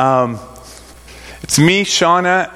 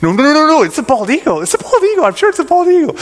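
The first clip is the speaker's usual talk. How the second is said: much higher and louder, bursting with it; can't contain it any longer; excited certainty; 13 semitones higher, 4 dB louder, faster than usual. Retold or imitated. Suddenly certain it is an eagle.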